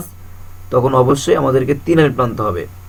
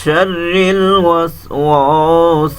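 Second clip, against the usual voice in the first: slow, drawn-out tajweed chant; measured Quranic recitation, each verse held; much slower than usual. Shown as a demonstration. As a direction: recite with long melodic stretches. Teacher recites surah in tajweed.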